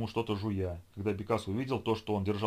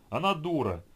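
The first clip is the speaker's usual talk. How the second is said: shout; 6 semitones higher, 4 dB louder than usual. A narrator's reading. Zombie shouts mid-fight.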